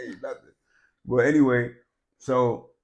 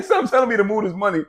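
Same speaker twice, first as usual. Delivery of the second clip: laughing repeat; 9 semitones higher, 6 dB louder, faster than usual. Retold or imitated. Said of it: "remember I kept saying".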